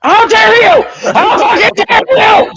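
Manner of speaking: very high, loud indignant yelling; outraged shout. Real, not acted. Cowboys fan answering co-host's jab, outraged shout. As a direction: explode at the insult, voice shooting up. Outraged at slight on his team.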